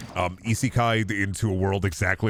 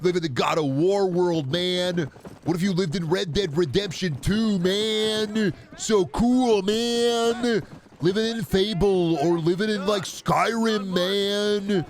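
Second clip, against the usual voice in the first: high, hyped dude voice, every "man" pitched up; mock gamer bro; 7 semitones higher, slower than usual. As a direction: imitate a gushing fan pitching fantasy worlds. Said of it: "Like, I know it's like".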